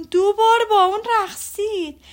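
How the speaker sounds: proud mother's delighted cry; thrilled squeal. Acted. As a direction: crow it out like the best news ever. Mrs Bennet's triumphant peak.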